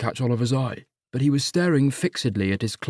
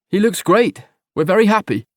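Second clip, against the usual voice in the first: higher and louder, over-emphatic; insistent partner; 9 semitones higher, 7 dB louder than usual. Acted. Partner defending the boyfriend to family, loud. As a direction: overcompensate with forced cheer.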